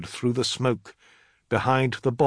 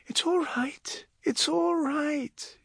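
soft, high, hushed reassurance; soothing; 4 dB quieter, slower than usual. A narrator's reading. Juniper comforting a crying child, soothing. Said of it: "Juniper soothed her".

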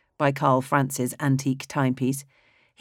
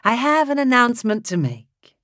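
much higher, sing-song theatrical conductor; high, mellifluous voice; 8 semitones higher, 4 dB louder than usual. Acted. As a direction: croon the greeting like a showman. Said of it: "in a high, mellifluous voice".

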